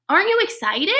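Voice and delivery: high, eager little bird; high excited question. Acted. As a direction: lift it up bright and eager.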